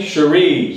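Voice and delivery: CHERIE said as "shuh-REE", falling on REE; model pronunciation. Shown as a demonstration. Evaluator leading audience repetition, clear model pronunciation. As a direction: lead the room in saying Cherie, second syllable long. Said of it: "Everybody say with me".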